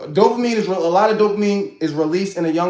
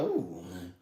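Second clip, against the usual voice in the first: soft swoon; 8 semitones lower, 16 dB quieter than usual. Retold or imitated. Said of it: "They're like".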